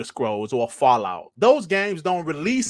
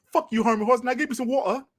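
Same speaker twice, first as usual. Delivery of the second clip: high-pitched mock-British cursing; cheeky put-on British, pitched up; fake British accent; 9 semitones higher, faster than usual. Host cursing Herman Hulst and demanding water, fake British accent. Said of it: "you just heard my fake British accent".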